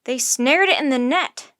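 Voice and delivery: loud shout near normal pitch; loud announcement to the crowd. A narrator's reading. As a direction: call out the news loudly. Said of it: "shouted Tommy".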